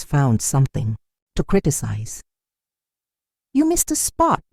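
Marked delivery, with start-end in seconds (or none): 3.5-4.4 much higher, fault-finding aunt voice; aunt picks at her niece's work, much higher; critical aunt. A narrator's reading. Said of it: "her aunt would say".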